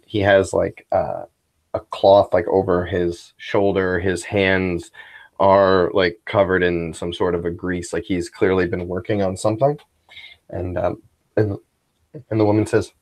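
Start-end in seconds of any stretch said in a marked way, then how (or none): none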